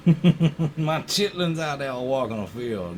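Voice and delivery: slow Southern farmer drawl; hammy slow Southern drawl; Southern accent. Pretend. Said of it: "why are you talking like that".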